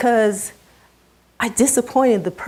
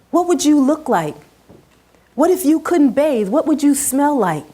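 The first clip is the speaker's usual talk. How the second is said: grandmother's raised, higher scolding; stern scolding; 5 semitones higher than usual. Retold or imitated. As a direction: scold the child, voice climbing in indignation.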